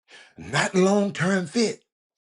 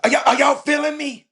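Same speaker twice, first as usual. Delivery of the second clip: loud hype call to the listeners; 7 semitones higher, 9 dB louder than usual. Real, not acted. Host shouting to his stream audience, loud and fired up. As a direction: shout it out to the whole room.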